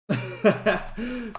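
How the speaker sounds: high-pitched chuckle; laugh. Real, not acted. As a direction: laugh at your own joke, high and easy. Amused at own tattoo joke.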